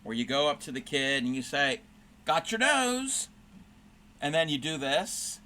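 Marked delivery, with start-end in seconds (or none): 2.2-3.3 high, slow teasing "got your nose"; playing the nose game with a little kid; playful nose game. Shown as a demonstration. Showing a child the nose game, high and playful. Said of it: "I taught her the nose game".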